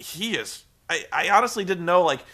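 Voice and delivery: normal talking voice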